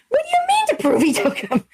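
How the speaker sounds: high-pitched retold disbelief; freaking out. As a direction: squeak it out, incredulous, half laughing. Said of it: "I was freaking out".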